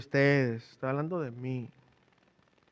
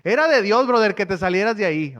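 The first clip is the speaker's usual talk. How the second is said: pastor laughing it off; 4 semitones higher, 10 dB louder than usual. Retold or imitated. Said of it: "Me dijo".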